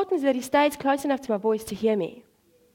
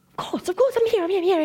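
high, eager dog voice; hammed-up eager compliance; excited dog; 8 semitones higher than usual. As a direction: tumble over yourself to obey. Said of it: "he's all excited".

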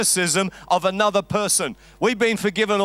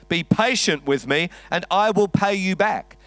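ordinary talk

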